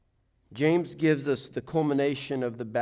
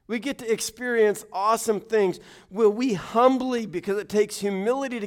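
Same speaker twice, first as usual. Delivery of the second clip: loud, high fervent preaching; preaching at full force; 9 semitones higher, 8 dB louder than usual. Acted. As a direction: build the sermon to a rousing peak. Preaching climax, sports analogy.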